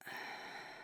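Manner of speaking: long, soft exhale; sigh. Acted. Before telling partner the news, a quiet sigh.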